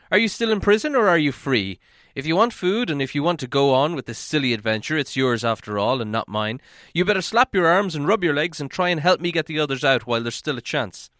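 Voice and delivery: loud, high, impatient scolding. Acted. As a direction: tell him off and hurry him up. Bilbo scolding the dwarf.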